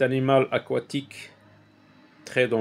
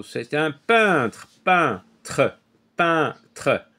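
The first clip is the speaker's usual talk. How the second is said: slow, each syllable drawn out for the class; lengthened syllables, teacher to pupils; slow teaching delivery; much slower than usual. Shown as a demonstration. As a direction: stretch it out so children hear the sound. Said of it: "j'entends le son un ici".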